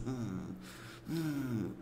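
low, quiet over-focused "hum"; mock intense listening with soft, low hums; "hum" de atenção exagerada. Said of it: "você tá lá assim". ("hum" baixinho e grave, fingindo concentração)

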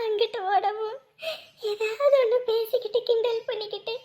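sobbing through the memory; tearful reminiscing. Acted. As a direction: recall fondly while crying. Mother weeps recalling son's childhood.

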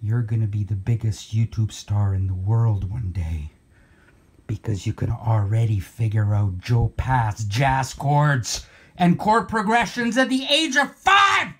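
foretell it low, then erupt at the end; fortune teller. Acted. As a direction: fortune teller's low pronouncement, breaking upward in disbelief.

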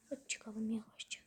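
whisper, dreading what comes; hushed dread; scared whisper. Real, not acted. Sneaking upstairs, afraid.

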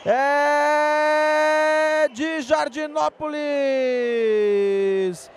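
long stretched goal scream; goal call. Real, not acted.

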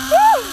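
loud, high whoop. Real, not acted. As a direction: let out a high "woo". Whooping while on obstacle course.

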